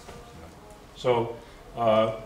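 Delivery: ordinary talk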